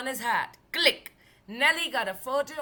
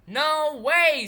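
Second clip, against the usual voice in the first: high, loud no way; loud refusal; 5 semitones higher, 10 dB louder than usual. Acted. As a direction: refuse with a fearful yelp. Driver refuses in fright.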